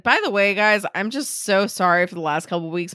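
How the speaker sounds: usual speaking voice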